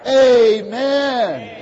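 loud, high, fervent amen and praise. Real, not acted. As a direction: lift it up loud to the Lord. Fervent shouted praise after declaration.

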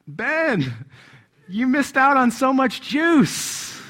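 high, loud, drawn-out scolding as mom; exclaiming mother. Retold or imitated. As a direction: imitate a dismayed mom calling out. Acts mother calling out at him.

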